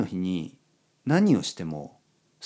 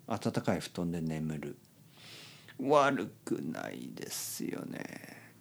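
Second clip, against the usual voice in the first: pillow talk; 4 semitones lower, 10 dB quieter than usual. Real, not acted. Bedtime talk, rainy night.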